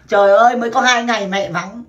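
usual speaking voice

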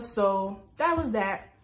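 ordinary talk, nothing marked

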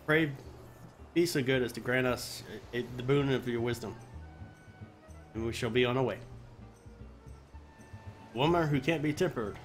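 usual speaking voice

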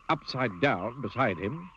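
ordinary delivery, nothing marked

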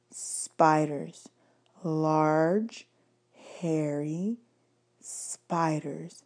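soft, slow, creepy. A narrator's reading. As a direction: drop the voice, spooky. Percy says her fear.

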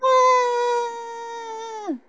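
put-on very high, sustained baby wail; baby crying. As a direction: bawl shrilly like a pretend baby. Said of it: "Que choro é mortal". (choro estridente de bebê fingido)

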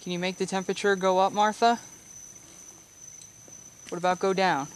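talking to a ghost. Real, not acted. Calling to the spirit Martha.

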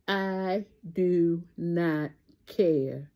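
drawn out, falling away; slow deliberate catchphrase. Real, not acted. Vlogger answering the drama, slow firm catchphrase. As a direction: let each word hang.

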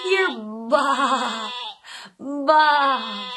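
sheep bleat. Shown as a demonstration.

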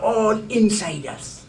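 ordinary delivery

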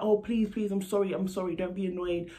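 mocking his frantic pleading; pleading mock grovel. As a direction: mimic a desperate man begging forgiveness. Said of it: "And he was like".

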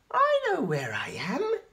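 slow, high little spider. A narrator's reading. Spinderella realises where she is, high and slow. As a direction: say it high and relieved.

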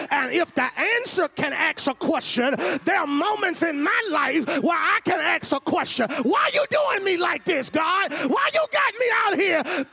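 loud, high preacher's cry to God; preaching at full force. Acted. Preacher voicing his own plea to God, shouting. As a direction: cry out the question at the top of your voice.

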